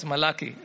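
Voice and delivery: Malachi ending in "kee", as in Malayalam; Malachi with an "ee" ending, rhyming with key; Malayalam pronunciation of Malachi. Shown as a demonstration. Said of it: "That's the right pronunciation".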